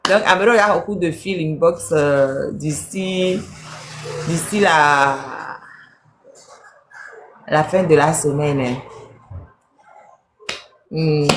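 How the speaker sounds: ordinary talk, nothing marked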